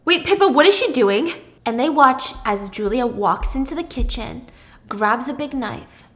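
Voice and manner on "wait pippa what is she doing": alarmed question. Acted. Alarm at knife.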